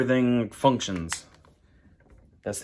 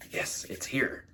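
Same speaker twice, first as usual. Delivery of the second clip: soft, unvoiced whisper; 10 dB quieter, slower than usual. Real, not acted. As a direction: whisper it like a small secret. Quiet aside about the package.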